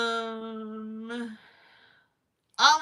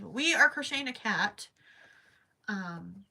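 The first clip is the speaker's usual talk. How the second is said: ordinary talk, nothing marked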